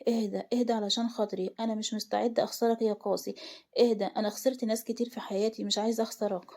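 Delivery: ordinary delivery, nothing marked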